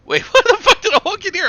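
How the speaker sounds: laughing hard while talking; cracking up, then blurting out; loud laughter. Real, not acted. Walks into absurd conversation.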